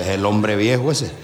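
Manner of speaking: ordinary talk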